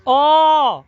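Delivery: ओ called out loud from the throat; loud vowel demo. Shown as a demonstration. Teacher to class, loudly sounding the vowel. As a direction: belt out ओ so the class hears it. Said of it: "बोलो, ओ".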